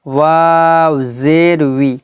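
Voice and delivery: slow, sustained chant of letter names; measured Quran-primer spelling drill; letter-drill recitation. Shown as a demonstration. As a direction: hold each letter name long, end on a short syllable.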